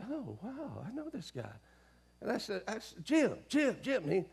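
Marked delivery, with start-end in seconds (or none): 0.1-1.5 soft, higher-pitched inner thought; hushed moment of recognition; thinking to himself. Retold or imitated. Man recognising someone he knows, thinking to himself. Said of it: "I'm thinking". 3.0-4.0 higher-pitched calls at ordinary volume; calling his name. Retold or imitated. Man trying to wake an acquaintance, calling his name. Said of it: "nothing was happening here".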